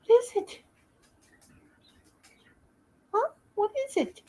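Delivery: high sing-song voice for a scared dog; pet talk. Real, not acted. Coaxing scared dogs.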